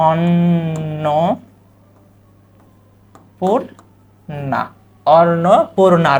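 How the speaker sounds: Annapurna slowly, the র sound clearly in it; slow pronunciation demo. Shown as a demonstration. Teacher answering how to say Annapurna, slow. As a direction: draw out Annapurna. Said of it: "শব্দটার উচ্চারণ কিভাবে করবো?".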